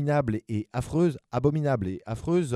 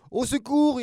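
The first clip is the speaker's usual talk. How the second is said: very high, loud panicked scream; screaming for help; 7 dB louder than usual. Acted. Scared giant monster fleeing the baby, screaming. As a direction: shriek for help in terror. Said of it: "hurla-t-il".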